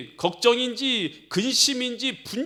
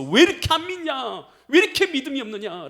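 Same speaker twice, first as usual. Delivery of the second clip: raised-voice reproach; rebuking; 6 semitones higher, 5 dB louder than usual. Acted. Jesus walking with blind disciples, raised rebuke. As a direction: push the question out as a scolding. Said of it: "주님께서 책망하시는 것이죠".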